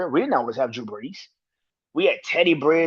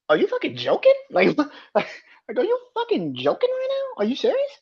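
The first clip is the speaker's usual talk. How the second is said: disbelief; 13 semitones higher than usual. Real, not acted. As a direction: laugh through the shock. Disbelieving rant.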